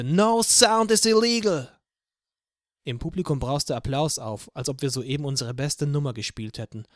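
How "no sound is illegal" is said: much higher, much louder, slow roar; roaring shout. Acted. Anna roars her slogan into the microphone, slow defiant shout. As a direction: bellow it into the microphone. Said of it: "und brüllte".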